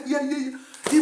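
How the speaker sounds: high, loud mock cries; imitated excited cries. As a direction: mimic a stranger hollering as he arrives. Imite les cris de l'homme. (cris imités, aigus et forts)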